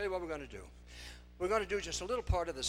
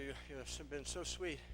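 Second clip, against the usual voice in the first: ordinary talk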